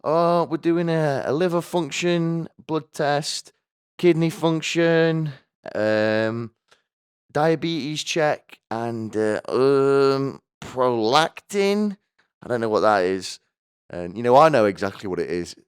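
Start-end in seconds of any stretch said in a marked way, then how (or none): 0.0-12.0 high, slow, sing-song nurse listing tests; a chirpy nurse reading off the tests. Retold or imitated. Nurse explaining the tests in a high, slow voice. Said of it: "she was like".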